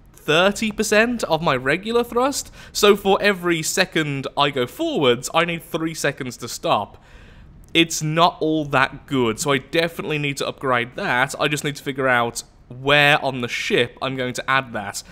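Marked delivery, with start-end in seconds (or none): none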